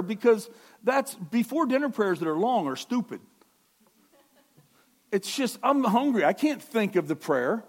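loud, high, fervent preaching; preaching at full force. Acted. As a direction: drive it home to the congregation.

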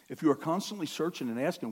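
ordinary talk

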